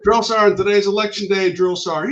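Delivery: high, loud formation reply; acted recruit's call; recruit shouting back. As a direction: sound off to the drill sergeant. Recruit answering the drill sergeant.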